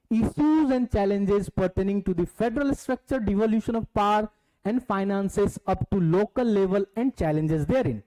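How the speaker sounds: slow reading aloud. Shown as a demonstration. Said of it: "reading syllabus points aloud slowly".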